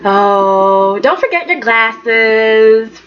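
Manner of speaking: slow, teasing jab; mock teasing. Thomas mocking Ridge about his age, slowly and teasingly. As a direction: drag it out to poke fun. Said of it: "he said stuff like".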